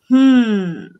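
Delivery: drawn-out, musing hum; a pondering "hmm", played up; mock pondering. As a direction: imitate someone puzzling it over. Imitating people puzzling over an accent.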